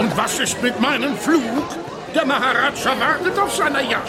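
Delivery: huffy and fast, complaining; indignant complaint. Acted. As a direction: demand your flight like a pompous VIP. Mayor complains about his flight.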